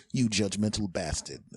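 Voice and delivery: normal talking voice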